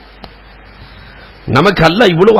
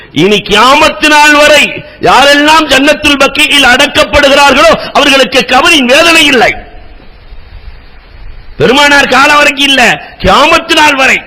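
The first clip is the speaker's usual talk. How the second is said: fervent preaching; 10 semitones higher than usual. Acted. Preacher retelling Bilal's question to the congregation, impassioned. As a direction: preach it with rising fervour.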